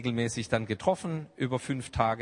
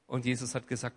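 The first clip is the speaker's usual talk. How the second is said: normal talking voice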